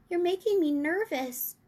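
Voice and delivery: put-on a mildly worried little girl; nervous girl. A narrator's reading. Sophie tells the giant he makes her nervous.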